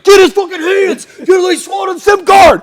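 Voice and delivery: very high, loud imitated cop shouting; frantic police yell. Police at a car stop after he swallowed evidence, frantic yell. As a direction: yell like panicked officers grabbing a suspect.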